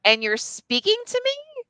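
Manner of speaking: high-pitched, starstruck disbelief; incredulous inside voice. Retold or imitated. Woman inwardly amazed her crush speaks to her, incredulous. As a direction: squeak it like you can't believe your luck. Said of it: "my inside voice".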